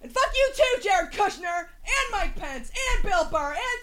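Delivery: very high wailed curse fading into the distance; high, receding singsong scream; raging out. Pretend. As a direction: keep yelling as you storm away, getting fainter. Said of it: "raging out in my mind".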